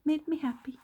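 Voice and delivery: high, laughing repeat of made me happy; giddy laughing echo. Real, not acted. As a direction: laugh it out, pitch jumps up.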